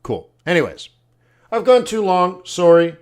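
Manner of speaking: ordinary delivery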